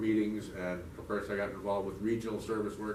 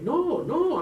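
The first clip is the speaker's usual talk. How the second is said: high, loud comic protest; hammed-up shouted refusal; arguing; 9 dB louder than usual. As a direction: argue back at full pitch. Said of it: "I'm arguing with this".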